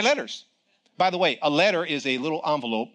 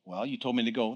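softer, lower, meek admission; sheepish reply; 6 semitones lower, 7 dB quieter than usual. Retold or imitated. Young preacher answering God, sheepish and soft. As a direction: own the mistake quietly. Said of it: "his own meek reply to God".

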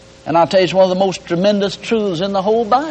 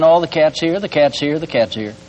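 ordinary talk, nothing marked

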